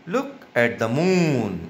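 slow read-aloud with "moon" held long; long vowel in moon. Shown as a demonstration. Teacher reading a sentence for children, moon drawn out slowly. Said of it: "long sound किसका जा रहा है".

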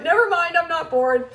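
imitating a girl retracting, high-pitched; high, loud little-kid retreat; hasty backing down. Daughter seeing mom's look, mock-alarmed retraction.

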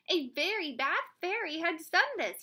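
king explaining. A narrator's reading. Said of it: "he explained".

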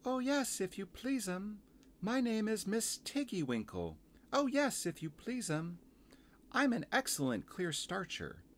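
very high, polite little laundress; Missus Tiggy Winkle. Acted. Small washerwoman introducing herself to visitor, high and deferential. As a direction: pipe up high like a curtsying storybook creature.

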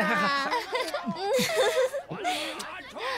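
high leering laugh; lecherous laugh. Acted. Drunk official carousing.

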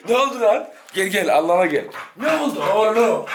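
high excited calling; excited calls to an angry dog. Real, not acted. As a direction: call the riled-up dog over, half laughing. Calming agitated dog.

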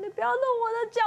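normal talking voice

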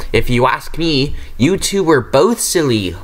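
ordinary delivery, nothing marked

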